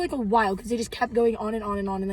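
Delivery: ordinary talk, nothing marked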